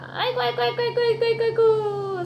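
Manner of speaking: high wailing aigo of a defeated devil; 사탄의 울부짖음. Acted. 사탄이 지는 장면, 울부짖음. (패배한 사탄처럼 높게 우는 아이고)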